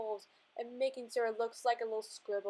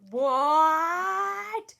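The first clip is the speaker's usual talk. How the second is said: loud, drawn-out shocked "what", higher pitched; 5 semitones higher, 9 dB louder than usual. Acted. Acting out a drawn character reacting to a show, shocked. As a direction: stretch it out in loud disbelief. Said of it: "He's very shocked".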